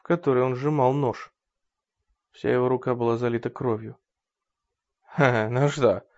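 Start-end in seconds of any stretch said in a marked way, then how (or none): 5.1-6.0 loud, higher mocking sneer; crooked smirk. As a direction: smirk as you admit the lie. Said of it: "криво усмехнулся Рой".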